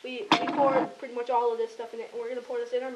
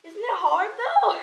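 laughing; 7 semitones higher, 7 dB louder, slower than usual. Real, not acted. Laughing while asking friend.

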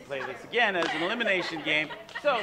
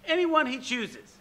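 ordinary talk, nothing marked

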